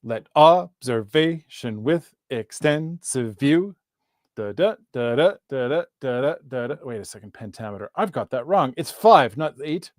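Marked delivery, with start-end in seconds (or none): 0.0-3.8 slow "Let observation with extensive view," every second syllable stressed; "Let observation with extensive view" in slow, measured weak-strong pulses; iambic stress. Shown as a demonstration. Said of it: "'cause it goes". 4.4-6.8 evenly pulsed weak-strong "da, da"; iambic beat. Shown as a demonstration. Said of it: "'cause it goes".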